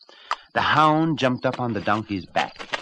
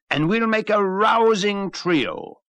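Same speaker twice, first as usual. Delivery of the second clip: rousing; 11 semitones higher, 6 dB louder, slower than usual. Acted. Donkey rallies the cat.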